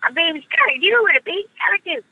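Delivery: put-on high raspy toddler voice; cartoon baby voice, high; Tommy Pickles voice. Shown as a demonstration. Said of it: "but Tommy Pickles, who is sort of a".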